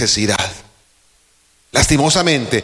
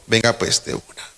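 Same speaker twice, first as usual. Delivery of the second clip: ordinary talk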